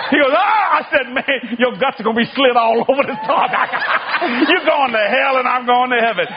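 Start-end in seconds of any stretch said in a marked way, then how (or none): 0.3-0.7 very high startled gasp; hammed-up panic; mock terrified oh. Atheist panics again. 1.1-6.3 gleeful mocking through laughter; mock gloating laugh; laughing taunt.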